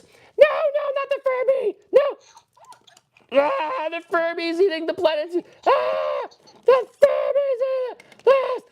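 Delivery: very high, squealing victim screams; mock terror. Toy Planeteers being fed to a Furby, mock-terrified screams. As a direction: shriek in hammed-up panic.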